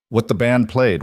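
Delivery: usual speaking voice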